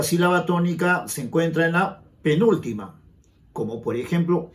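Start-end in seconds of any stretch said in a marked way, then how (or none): none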